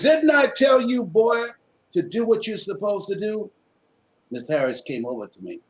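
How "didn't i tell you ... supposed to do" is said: scolding shout. Retold or imitated. Mother scolds son in retold scene.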